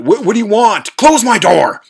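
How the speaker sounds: high, loud, furious demand; shrieking at the intruder to get out; angry yell. Retold or imitated. Person in the room yells at the intruder to close the door.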